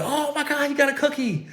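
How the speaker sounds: high-pitched, hushed mock amazement; retold squeal of delight, kept soft; excited reaction. Parents react to cookie reveal.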